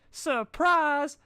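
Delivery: very high, loud party shout; yelled surprise. A narrator's reading. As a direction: leap out and shout it. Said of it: "yelled everybody".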